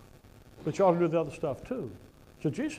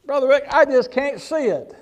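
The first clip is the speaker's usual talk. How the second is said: whiny objector voice, higher and louder; mock congregant; 9 semitones higher, 13 dB louder than usual. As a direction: whine it like a stubborn listener.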